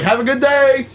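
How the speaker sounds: sarcastic bright farewell; mock cheerful. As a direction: chirp it sweetly like nothing happened. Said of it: "And he's like".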